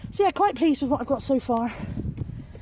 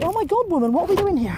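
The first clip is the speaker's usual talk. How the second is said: higher-pitched mock grumble from her own aching arm; complaining arm voice; 7 semitones higher, 3 dB louder than usual. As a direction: whine at her like an exhausted limb. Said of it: "It's like".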